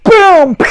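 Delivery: loud, high shouted boom; crash sound effect. Retold or imitated. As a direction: bang it out like the raft slamming into you. Another raft hits her.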